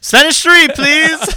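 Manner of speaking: very high, loud joke squeak; mock pleading student. Playing a student asking for Spanish three, high mock plea. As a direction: beg in a hammed-up falsetto. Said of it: "You should be like".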